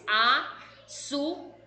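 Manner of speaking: Açúcar in three slow pieces, a, çú, car; split into syllables. Shown as a demonstration. Teacher separating syllables for pupils, very slow and measured. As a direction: break Açúcar apart with gaps between syllables. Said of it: "vai separar as sílabas".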